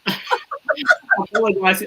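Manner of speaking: normal talking voice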